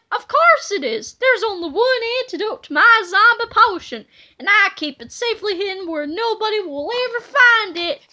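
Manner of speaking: villain boast. Acted. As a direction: brag about the hiding place. Petey boasts.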